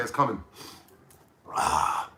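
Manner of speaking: usual speaking voice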